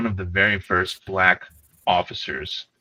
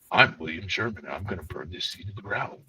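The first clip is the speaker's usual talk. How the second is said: There Will Be Blood voice; 8 dB quieter than usual. Retold or imitated. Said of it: "I imagine him sounding like the dude in There Will Be Blood".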